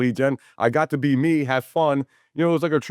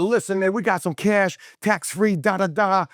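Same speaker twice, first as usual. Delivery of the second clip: mock hyped pitch; 7 semitones higher than usual. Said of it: "I'm, like, going nuts".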